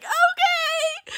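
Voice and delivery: very high wailed okay; hammed-up sobbing squeal, drawn out; mock crying. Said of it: "just fucking crying".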